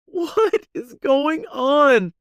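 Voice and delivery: very high, drawn-out panicked shout; startled shout. Retold or imitated. Passenger reacts to Kat's sudden scream, startled shout. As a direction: shriek it slowly in alarm.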